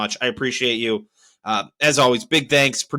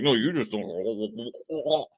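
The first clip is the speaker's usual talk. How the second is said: drawn-out, softer mock of a smug agent; mocking slow dismissal; 3 semitones lower, 7 dB quieter, much slower than usual. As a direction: stretch it slow and quiet like a condescending rep.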